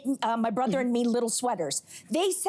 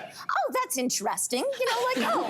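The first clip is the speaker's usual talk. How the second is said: polite surprise; 8 semitones higher than usual. Retold or imitated. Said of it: "and I remember thinking".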